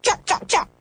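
high, light jackdaw calls; jackdaw cries. A narrator's reading. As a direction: mimic the birds calling chuck in a high pitch.

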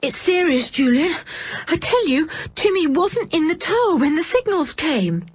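put-on high, urgent child's voice; trembling alarm. A narrator's reading. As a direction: insist anxiously that it is serious. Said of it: "she was trembling too".

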